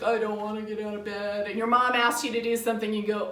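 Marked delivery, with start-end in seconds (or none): 0.0-1.5 mock grumpy morning grumble; hammed-up reluctance. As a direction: whine like a kid who won't get up. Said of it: "like, all grumpy".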